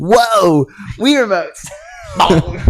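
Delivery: slow, drawn-out amazement; amazed. Retold or imitated. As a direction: marvel at it slowly. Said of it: "He was like".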